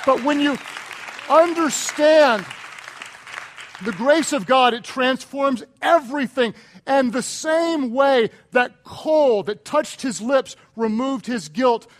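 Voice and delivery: loud, high, stretched proclamation; full-force preaching. Real, not acted. Preacher proclaiming God's grace to congregation, full force. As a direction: let the key words ring out.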